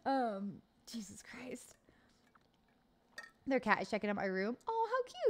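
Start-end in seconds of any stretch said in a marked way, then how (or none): 0.1-1.7 slow hushed whisper. Real, not acted. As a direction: breathe it under your breath. Whispered aside.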